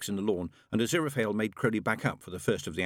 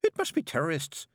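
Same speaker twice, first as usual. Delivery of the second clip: snapped; 8 semitones higher than usual. Acted. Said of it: "she snapped".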